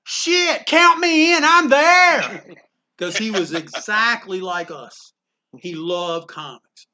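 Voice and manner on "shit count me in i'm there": loud, high retold whoop of agreement; exuberant acceptance. Artist accepting the convention invitation, acted thrilled and loud. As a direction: belt it out as the thrilled guest.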